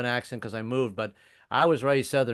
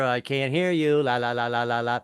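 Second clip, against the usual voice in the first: put-on childish blocking-out. Hitchhiker refusing a lonely truck driver, childish sing-song. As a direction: drone over him so nothing gets heard.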